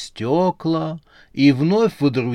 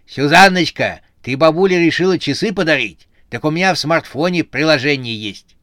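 put-on loud, higher animated old-witch voice; chatty granny; 5 semitones higher, 6 dB louder than usual. A narrator's reading. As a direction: address the boss with brash familiarity. Old witch pertly addresses boss.

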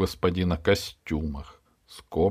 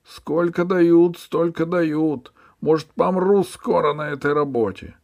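whining ferryman; 11 semitones higher, 6 dB louder than usual. Acted. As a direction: plead poverty. Ferryman whines. (высоко, жалобно и протяжно)

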